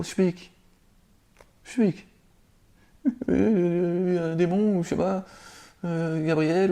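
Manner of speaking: very high, stammering, scared little voice; mock terrified squeak. Preacher mocking a frightened husband babbling to his wife in a very high voice. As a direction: ham up a panicked man babbling.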